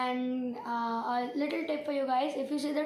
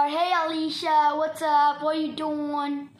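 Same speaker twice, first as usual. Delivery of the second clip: a casual American accent, louder on the greeting; 6 dB louder, slower than usual. Shown as a demonstration. Son demos his American accent for his mother. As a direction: drop into an easy American accent to greet a friend. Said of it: "give us a demo of the American accent".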